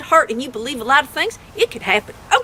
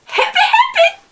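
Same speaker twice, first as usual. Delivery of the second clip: much higher, louder, laughing happy, happy; laughing catchphrase; 4 dB louder than usual. Real, not acted. Signing off her show to fans, a laughing, excited cheer. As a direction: belt out the sign-off with a laugh.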